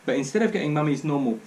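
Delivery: plain reading voice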